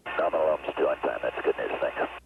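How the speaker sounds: astronaut radio. Real, not acted. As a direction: voice over a space radio link. Astronaut reading back over radio.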